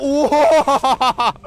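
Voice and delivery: loud whoop breaking into a laugh; relieved laughing cry. Real, not acted. Landing after the scary swing, laughing whoop. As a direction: laugh out the fright.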